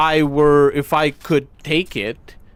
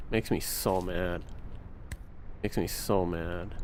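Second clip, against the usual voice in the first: quiet seething; 5 semitones lower, 13 dB quieter than usual. Real, not acted. Repeated low complaint.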